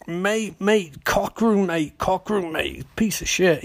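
lightly voiced homeless man, slightly high; slow, drawn-out "mate" in a put-on street voice; homeless guy. Homeless man ranting to the doctor, lightly put-on voice. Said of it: "Homeless guy is sort of like".

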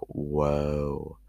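drawn-out scandalised whoa; mock shocked whoa. Hearing something dirty-sounding, a mock shocked whoa. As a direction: react as if something was dirty. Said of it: "I would be like".

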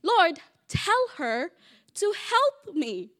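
slow, high, insistent command; demanding. Retold or imitated. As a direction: spell the order out slowly. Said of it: "She's saying".

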